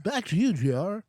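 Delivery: high, loud anchor sign-off; newscaster voice. Pretend. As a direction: echo the anchor toss, hammed up. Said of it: "talk like a newscaster".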